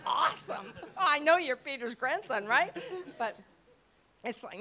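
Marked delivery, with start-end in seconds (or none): 0.1-2.8 mock excited. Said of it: "I was like".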